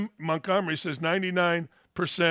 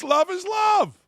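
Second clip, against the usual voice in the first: high, loud mock shout of the slogan; hammed-up shout; 12 semitones higher, 4 dB louder, slower than usual. Host mocking the slogan about the coach's romance, shouting. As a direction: yell it like a fervent true believer.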